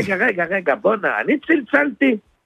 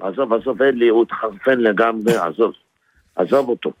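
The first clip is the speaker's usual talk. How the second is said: usual speaking voice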